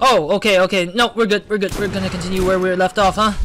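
loud, high relieved burst; excited relief. Real, not acted. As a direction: blurt it out, relieved and pumped. Relief after a scare in game.

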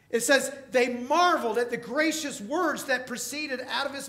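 loud, high preacher's build; rising revival preaching; full-force preaching. Acted. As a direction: drive it home from the pulpit.

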